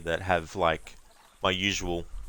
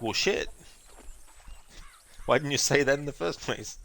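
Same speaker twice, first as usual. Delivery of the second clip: mock relieved victim; 7 semitones higher than usual. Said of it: "And they're like".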